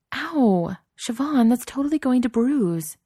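high, loud yelp of pain, then complaining; pained outcry. A narrator's reading. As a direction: yelp after being punched hard. Punched in the arm, cries out.

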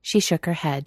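ordinary delivery

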